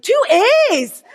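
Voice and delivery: imitating a Scottish college admissions voice; Scottish accent. Scottish college staff questioning a phone applicant, Scottish accent. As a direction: query it in a mock Scottish accent. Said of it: "'cause they were Scottish".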